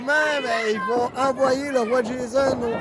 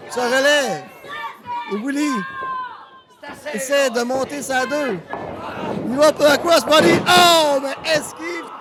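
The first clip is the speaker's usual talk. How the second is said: wrestling commentator; 5 semitones higher than usual. Acted. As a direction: build the hype for the big move. Live wrestling play-by-play. (commentateur de catch qui s'emballe)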